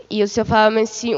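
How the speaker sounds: ordinary talk, nothing marked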